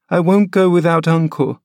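put-on high, stubborn child's cry; a frail boy refusing to budge; petulant boy. Linton refusing to leave without uncle, high petulant boy. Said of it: "he cried at length".

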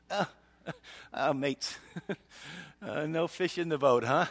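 lightly done British ribbing; "mates" with a chuckle, a hint of British; British accent. Jesus teasing his fishermen mates, faint British accent. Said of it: "He gets British at this point".

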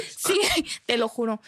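laughing through the words. Real, not acted. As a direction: chuckle while swearing it's true. Laughing at the mosquito story. (riendo mientras jura que es verdad)

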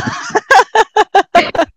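high, loud burst of real laughter; a bright peal of laughing; delighted laugh. Real, not acted. Laughing at host's prank story.

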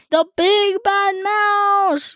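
high, drawn-out frightened yell. Acted. Gruffalo's child sees the giant shadow and yells, high and slow. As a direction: cry it out in fright. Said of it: "yelled the Gruffalo's child".

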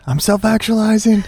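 high, giggly copy of the mantra; mocking brother. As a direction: tease him by parroting his mantra. Teasing brother with his mantra.

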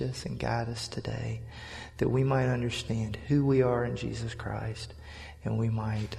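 soft, hushed prayer to the Father. Real, not acted. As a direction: pray quietly and reverently. Pastor prays before the sermon.

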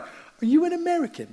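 very high-pitched imitation of an awestruck kid; excited English kid. English kids meeting visiting Americans, mock excited high voice. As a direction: squeal it like a starstruck child. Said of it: "The kids, especially in Teesside".

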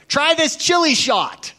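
high, loud, slow offer; hammed-up tipsy neighbor; pushy party guest. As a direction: egg him on to try the drink. Neighbor pushes a drink on him.

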